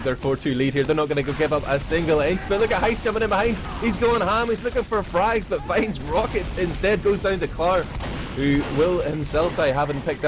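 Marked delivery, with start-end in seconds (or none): 2.5-7.8 higher, eager live game call; excited play-by-play. Real, not acted. Caster spots Heist jumping in behind, excited. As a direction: jump on the sudden flank with excitement.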